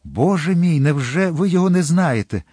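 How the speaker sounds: high, loud, urgent appeal; agitated pleading. A narrator's reading. Young man presses his beloved for a forgotten name, agitated pleading. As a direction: beg for it in alarm. Desperate questioning about the poisoner's name.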